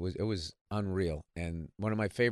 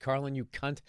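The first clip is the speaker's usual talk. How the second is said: ordinary talk, nothing marked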